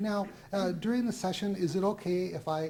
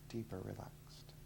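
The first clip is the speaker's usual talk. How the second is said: right in your ear; 7 semitones lower, 13 dB quieter, slower than usual. Acted. Hypnotist deepening the trance.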